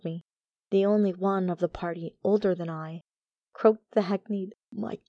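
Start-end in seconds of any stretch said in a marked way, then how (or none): 4.7-5.0 hoarse, cracked, breathless gasp; cracked whisper. A narrator's reading. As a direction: whisper it with a broken voice. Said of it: "in the most cracked voice I ever heard".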